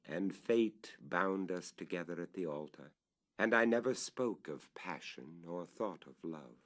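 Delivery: ordinary delivery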